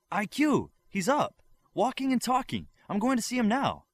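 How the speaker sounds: high, loud, breathless joy; excited shouting. Acted. Olympian rushing to see the awakened boy, high loud excited shouting. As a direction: shout the news as you rush past.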